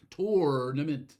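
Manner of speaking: Tournament said slowly with tor, loud; Philly tournament. Shown as a demonstration. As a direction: Tournament with a full tor, unhurried. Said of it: "I say tournament".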